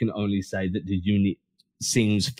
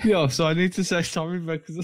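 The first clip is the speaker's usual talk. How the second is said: giggling through an apology; laughing; 6 semitones higher than usual. Real, not acted. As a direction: laugh while saying sorry. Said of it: "I laughed when you said".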